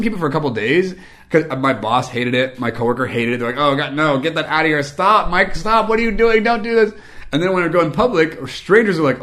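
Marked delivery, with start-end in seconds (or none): 3.6-6.9 loud, high imitation of horrified coworkers; hammed-up pleading to stop it; mock appalled. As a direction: protest in escalating disgust. Boss and coworker hating it.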